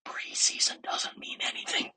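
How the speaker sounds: hushed whisper, all in one phrase; whispered reminder. Shown as a demonstration. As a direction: whisper it as one pointed sentence. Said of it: "I'm gonna whisper it for you again".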